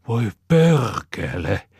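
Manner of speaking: high, loud, slow rasped curse; rasping curse. A narrator's reading. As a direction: drag the oath out, rasping. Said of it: "sorauttaa Topi".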